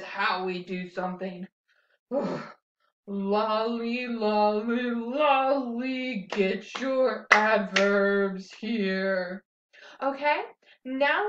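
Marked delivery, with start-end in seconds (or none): 2.1-2.4 grumpy sigh. Shown as a demonstration. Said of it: "let's do it grumpily". 3.1-9.4 grumpily. Shown as a demonstration. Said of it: "let's do it grumpily".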